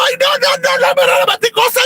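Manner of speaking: very high squeal of disgust; horrified squeal. Retold or imitated. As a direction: recoil in mock horror. Reacciona horrorizada a la suegra. (chillido agudo de horror fingido)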